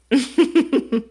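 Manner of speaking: loud, high burst of laughter; real rhythmic cackle of amusement; amused laugh. Real, not acted. Amused by the card about money. (grand éclat de rire)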